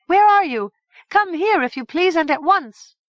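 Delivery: high, loud calls for the king; crying out. A narrator's reading. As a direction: call across the meadow to the castle. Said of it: "cried out".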